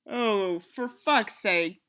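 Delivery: ordinary talk, nothing marked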